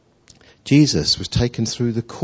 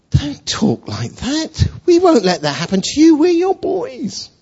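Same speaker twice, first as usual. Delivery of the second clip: earnest protest; 12 semitones higher, 4 dB louder than usual. Acted. Simon tells Jesus his boys will protect him, protesting. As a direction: insist on loyalty, climbing higher and higher.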